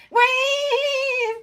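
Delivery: very high, loud squawking duck yell; Donald Duck mad. Retold or imitated. Showing how Donald Duck gets mad, squawky duck shout. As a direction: squawk it out like an angry cartoon duck. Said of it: "I can do his mad".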